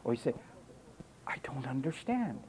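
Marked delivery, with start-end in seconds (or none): 1.2-2.4 whispered, mocking the listener's excuse. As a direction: breathe it like a sulky whisper.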